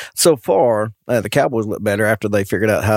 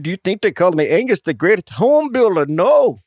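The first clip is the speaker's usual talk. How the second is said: higher, indignant Scotsman sounding Transylvanian; botched Scottish accent; 6 semitones higher than usual. Retold or imitated. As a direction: build to an emphatic no in bad Scottish accent. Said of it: "That did sound kinda Transylvanian".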